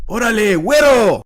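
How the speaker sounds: high, loud call-out; a big friendly shout over distance; yelling across the street. Acted. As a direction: holler it to someone across the street. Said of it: "yell across the street".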